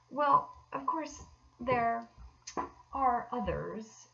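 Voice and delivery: slow, halting, reluctant start; hesitating. Acted. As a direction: drag the words out, unwilling to say it. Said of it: "in a hesitating sort of way".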